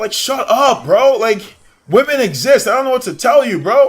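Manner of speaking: high and loud, fed-up shouting; exasperated rant. Real, not acted. Streamer scolds sexist gamers, loud and high with exasperation. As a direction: tell them off, fully fed up.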